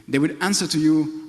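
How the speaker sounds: usual speaking voice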